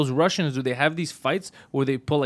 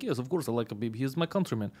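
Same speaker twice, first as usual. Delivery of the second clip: lower, much softer put-on answer; mild-mannered reply; 3 semitones lower, 10 dB quieter than usual. Russian fan innocently confirming support, soft and low. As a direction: answer quietly and matter-of-factly. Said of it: "He's like".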